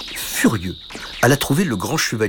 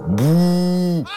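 higher, louder monster boo; dragon's boo; 8 semitones higher, 4 dB louder than usual. A narrator's reading. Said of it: "Il cria".

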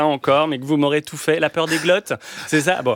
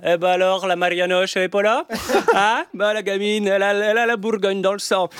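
put-on higher Burgundy accent with rolled r's; Burgundian accent; 5 semitones higher than usual. Villager remarking on the girl from Burgundy, rolled-r Burgundian accent. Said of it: "je roulais encore les airs".

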